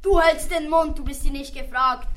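high, snapping at the snail; a king silencing a snail, mildly; mild mock shout. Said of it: "schrie der König".

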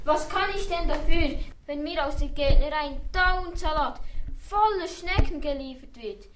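high, complaining plea; lightly voiced poor cook protesting; lamenting cook. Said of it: "und klagte".

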